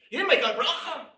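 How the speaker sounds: put-on high, loud scolding of a small child; frantic father scolding toddler; mock parent yell. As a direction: overblown parental outburst.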